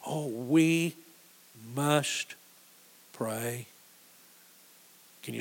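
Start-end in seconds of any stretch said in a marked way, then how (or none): 0.3-3.7 "we ... must ... pray", each word set apart; drawn-out, weighty pauses between "we", "must" and "pray"; word-by-word emphasis. Real, not acted. Preacher answers what can be done for these people, "we ... must ... pray" word by word.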